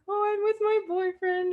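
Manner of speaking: small, high guilty admission; sheepish. Retold or imitated. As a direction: confess it sheepishly. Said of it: "like, a sheepish voice".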